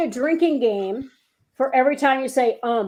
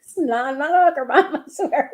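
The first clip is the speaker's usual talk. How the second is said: pleading; 4 semitones higher, 5 dB louder than usual. Retold or imitated.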